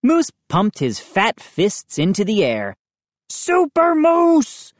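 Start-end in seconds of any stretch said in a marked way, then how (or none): 3.3-4.7 high, slow, stretched-out hero cry; triumphant bellow. A narrator's reading. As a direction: bellow like a boasting superhero. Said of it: "he bellowed".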